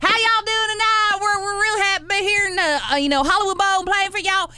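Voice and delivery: high, hammed-up Southern twang; fake Southern twang. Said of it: "such a put-on fake accent".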